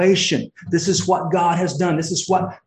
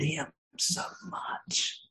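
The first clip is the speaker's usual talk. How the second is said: breathy hushed whisper, slow; 14 dB quieter, slower than usual. Retold or imitated. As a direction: whisper it in shame, slowly. Paul's confession, hushed.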